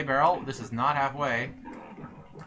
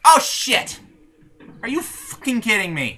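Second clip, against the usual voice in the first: loud, high angry disbelief; shocked outburst; 8 semitones higher, 8 dB louder than usual. Real, not acted. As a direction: blurt it in shock. Sudden game disaster.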